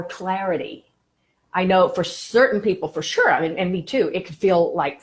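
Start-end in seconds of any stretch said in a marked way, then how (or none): none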